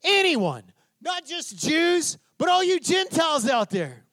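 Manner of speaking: high, drawn-out proclamation to a huge crowd; full-voiced open-air preacher's call; shouting to crowds. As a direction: shout the invitation out to everyone. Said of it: "stood up and shouted to the crowds".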